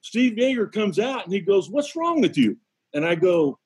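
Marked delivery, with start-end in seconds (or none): 1.7-2.6 retold question pitched high; mock demand, voice jumping up; puzzled demand. Catcher comes out to check on the pitcher, high puzzled demand. Teammate confronts the dazed pitcher.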